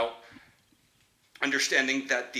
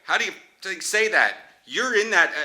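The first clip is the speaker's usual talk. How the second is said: loud, higher-pitched hail; raised voice, pitched up; calling out; 6 semitones higher, 8 dB louder than usual. Real, not acted. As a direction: call across to get someone's attention. Calling out to Jacob.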